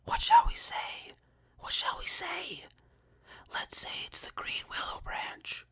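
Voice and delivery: frantic hushed whisper; feverish whisper. A narrator's reading. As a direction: panic under your breath. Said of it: "whispering feverishly again".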